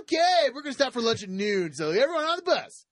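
peppy tour guide. Pretend. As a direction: pump up the crowd.

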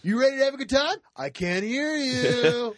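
peppy tour guide. Pretend. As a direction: pump up the crowd.

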